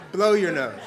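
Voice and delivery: loud line through laughter; laughing. Acted. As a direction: act it out while cracking up. Acted mother, teller cracking up.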